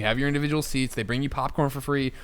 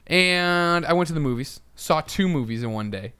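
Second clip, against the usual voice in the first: ordinary talk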